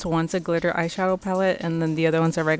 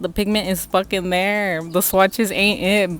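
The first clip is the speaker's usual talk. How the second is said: ordinary talk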